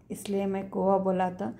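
usual speaking voice

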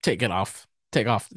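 low-key disgusted teacher; quiet, curt retold order with distaste. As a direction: imitate a teacher's understated disgust. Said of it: "she looked disgusted".

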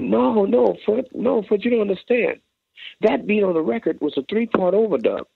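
high, eager rush of words; excited explanation. Retold or imitated. Randy to Sugarfoot, excited explanation. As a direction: explain it, thrilled at the discovery.

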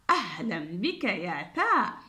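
normal talking voice

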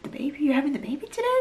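high, incredulous questioning, imitating a startled mother; acted surprise. Mother hearing her daughter's baby news by phone, surprised. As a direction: jump up in pitch as if just hearing the news.

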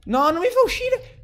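very high, loud shriek of protest; high-pitched panicked yell; desperate scream. Real, not acted. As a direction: scream in real disbelief. Game will not let player out.

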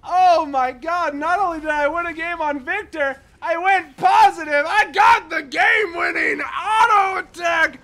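very high, loud ecstatic yelling; elated shouting; victory scream. Real, not acted. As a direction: scream like you just won. Winning the game.

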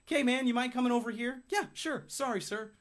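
lightly voiced polite request and answer; polite exchange. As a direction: play both sides as courteous. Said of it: "It'd be like".

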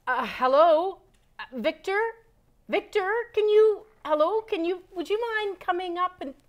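high, loud calling to wake someone; sing-song hello to rouse a sleeper. Real, not acted. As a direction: call up to a sleeping guest. Waking sleeping bat guest.

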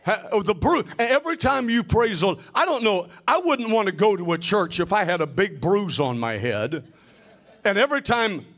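ordinary delivery

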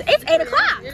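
high, loud cry of surprise; startled disbelief. Real, not acted. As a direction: blurt it out, shocked at the time. Startled at the time just told.

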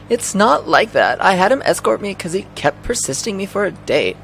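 high, protesting woman's voice; Aya. Acted. Aya explaining why he escorted her, high-pitched and protesting. Voicing Aya, defending herself.

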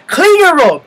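loud, high imitation of a nagging mom; high shouted parental command, acted out; mom's yelled order. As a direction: yell it like a mother ordering her kid. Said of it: "but she goes".

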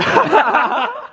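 high burst of laughter; sudden high laugh. Real, not acted. Laughing at old photo.

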